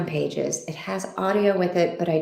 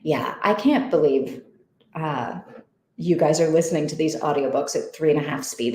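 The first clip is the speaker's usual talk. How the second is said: normal talking voice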